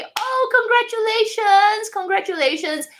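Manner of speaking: beam the congratulations; excited congratulations. Real, not acted. Congratulating Katie on her new bag, high and delighted.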